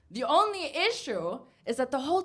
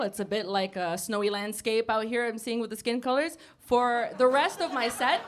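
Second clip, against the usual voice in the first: ordinary talk, nothing marked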